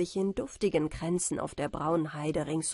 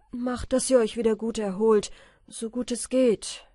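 urging; 3 semitones higher, 6 dB louder than usual. A narrator's reading. Michael urges battered family to recover.

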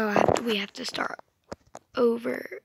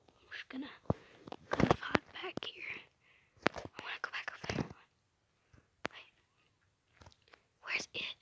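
soft whisper while hiding; hushed; 3 semitones higher, 7 dB quieter than usual. Real, not acted. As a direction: whisper it to yourself, staying hidden. Hiding from the seeker.

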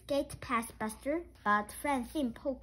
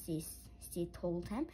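ordinary delivery, nothing marked

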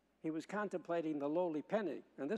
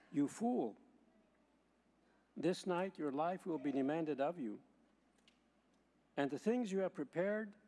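Gospel reading. Acted. Proclaims the Gospel at Mass.

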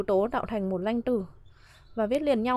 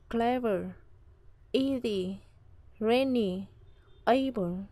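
clever, easy, rainy, able, each stressed up front; stress on first syllable; much slower than usual. Shown as a demonstration. Teacher lists adjective examples clever, easy, rainy, able, first-syllable stress. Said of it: "trọng âm rơi âm tiết thứ nhất".

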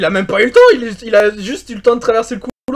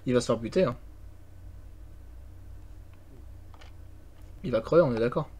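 softly, hushed while hiding; whispering while hiding; 8 semitones lower, 16 dB quieter than usual. Real, not acted. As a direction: keep it down so the ghost can't hear. Teammate hiding from ghost.

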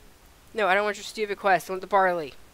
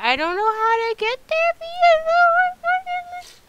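mock wailing whine; 4 dB louder, much slower than usual. Player lost on the way, mock wailing whine. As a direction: voice climbing into a very high, drawn-out whine. Whining about being lost.

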